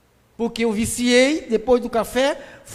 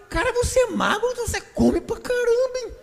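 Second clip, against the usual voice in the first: mock friend teasing; 11 semitones higher than usual. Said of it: "O cara fala".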